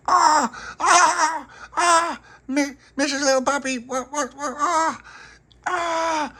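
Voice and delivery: very high, loud comic wail with laughter; mock wailing. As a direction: howl like the flannel is torture. Tony wails as face scrubbed.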